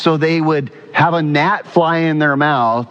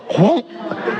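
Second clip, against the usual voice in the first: imitated whoosh; 11 semitones higher than usual. Fly flying into his throat.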